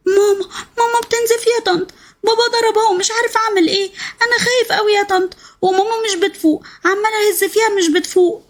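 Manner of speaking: put-on high child's voice, frightened and pleading; scared little boy. Boy Zein telling his aunt on the phone that mum is bleeding, high scared child voice.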